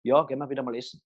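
a dull yes with no spark; unenthusiastic yes. Shown as a demonstration. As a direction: say it like it's just a chore. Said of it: "es brennt noch innerlich in einem".

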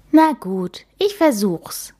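plain reading voice

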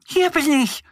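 a hint of a high little duck answering; lightly voiced duckling calling back. A narrator's reading. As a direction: duck replies to her friend Mo, lightly high. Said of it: "antwortet Annie".

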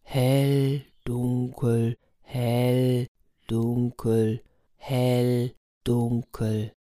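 measured tortoise counting, evenly spaced; steady, careful word-by-word delivery; slow and deliberate. A narrator's reading. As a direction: point out each item unhurriedly. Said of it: "langsam und bedächtig fing die Schildkröte an".

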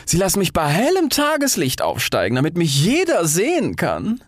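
high, swooping, grand rocket voice; vain delight. A narrator's reading. Rocket expecting everyone to watch, high and self-important. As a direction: gloat in a high voice.

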